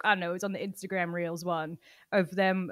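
ordinary talk, nothing marked

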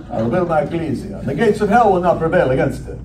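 hammed-up Transylvanian count voice through a laugh; mock Transylvanian accent. Preacher joking ecclesia sounds Transylvanian, mock Transylvanian accent. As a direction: joke the word "ecclesia" in a Transylvanian vampire accent. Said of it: "Sounds kinda like Transylvania".

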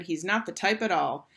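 ordinary delivery

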